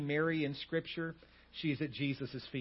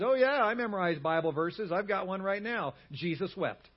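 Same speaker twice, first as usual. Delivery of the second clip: mock-cocky, higher and louder at first; snarky reply; 4 semitones higher, 5 dB louder than usual. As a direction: play the smart aleck with an easy answer. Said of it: "the guy kind of snarky responds".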